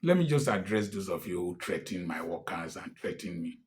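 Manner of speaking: normal talking voice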